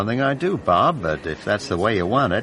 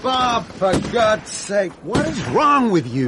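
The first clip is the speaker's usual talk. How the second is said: exasperated director; 9 semitones higher than usual. Real, not acted.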